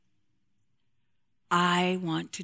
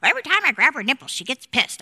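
quoting a man; 6 semitones higher, 6 dB louder, faster than usual. Retold or imitated. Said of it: "I had a man one time say".

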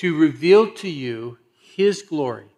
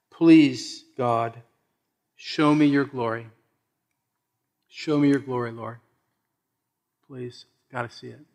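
pleading prayer; 4 semitones lower than usual. Acted. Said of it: "Moses says".

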